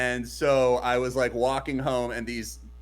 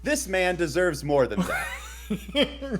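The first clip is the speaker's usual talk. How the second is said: laughing; 3 semitones higher, much slower than usual. Real, not acted. Mock solemn laughing remark.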